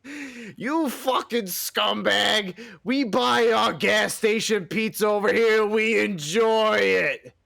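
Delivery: high, slow, drawn-out tirade; mock furious retort. Co-host defends gas station pizza, in mock fury. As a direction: hurl back a hammed-up insult and boast.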